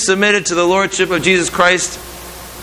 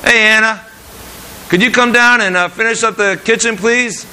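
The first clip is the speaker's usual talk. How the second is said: high, cheerful, easygoing ask; friendly request; 5 semitones higher than usual. Shown as a demonstration. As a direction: invite her down warmly. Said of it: "There's a difference there".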